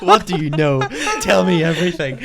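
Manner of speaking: high, loud laughing demand; laughing mock interrogation. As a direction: bark the questions through laughter.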